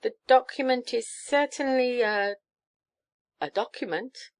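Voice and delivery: hesitant, stalling verdict; hedging. A narrator's reading. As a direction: stall on "a", then settle lamely. Archbishop hedging over the paper.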